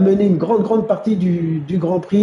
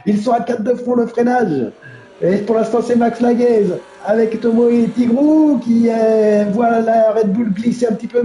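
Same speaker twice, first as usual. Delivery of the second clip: lively live play-by-play; motorsport commentator; 8 semitones higher than usual. Real, not acted. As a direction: call the race as it happens. (commentateur de course automobile)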